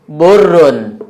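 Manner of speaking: burrun with dhammah, rounded u; Arabic pronunciation demo. Shown as a demonstration. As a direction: say burrun carefully, u vowel after b. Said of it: "Ada lagi". (ucapkan burrun dengan dhammah jelas)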